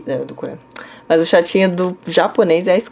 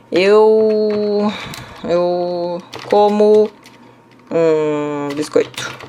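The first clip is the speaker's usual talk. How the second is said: slow, spaced teaching demo; slow example sentence; much slower than usual. Shown as a demonstration. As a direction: lay each word out slowly. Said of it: "se eu quiser dizer, por exemplo". (frase de exemplo bem devagar)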